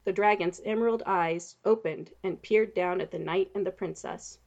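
plain reading voice

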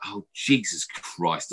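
very slow, drawn out; exasperated sigh. Retold or imitated. Caught over the line, exasperated. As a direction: let it out wearily.

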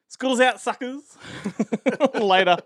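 high, loud taunting farewell; gleeful shout. Real, not acted. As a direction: yell it out like the bell rang.

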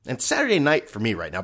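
usual speaking voice

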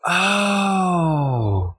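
long, drawn-out falling oh; drawn-out oh of late discovery; dawning realization. Real, not acted. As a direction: let a slow "oh" of realization slide down. Learns the nickname from co-hosts.

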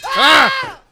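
high, loud yell to cut in. Real, not acted. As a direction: yell out to stop the crosstalk. Loud outcry over crosstalk.

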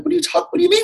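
very high mock confused voice; imitating the manager. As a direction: squeak it out like a baffled boss. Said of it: "he'd be like".

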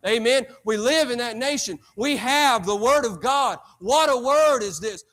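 preacher hammering the point home; preaching at full force. Real, not acted.

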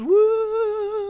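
high-pitched "woo"; cheering whoop. Real, not acted. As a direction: let out a long, high cheer. Cheering for their raid clears.

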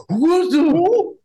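high, slow stunned squawk; shocked. Retold or imitated. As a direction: gasp it out in disbelief. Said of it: "And they go".

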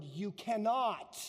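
CANNOT stretched out slowly and firmly; slow, weighty stress on "cannot"; emphatic. Retold or imitated. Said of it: "then the emphatic".

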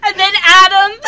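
loud laughing outburst; laughing exclaim. Real, not acted. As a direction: blurt it out through a laugh. Bursting into laughter.

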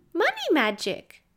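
put-on high, emphatic child; a small girl stating the obvious; little girl voice.

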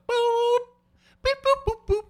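very high, loud beep-like vocal noise; a piercing single boop; mock shower singing. Retelling deaf campers singing in the shower, high boop. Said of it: "secondhand impression of a deaf person singing".

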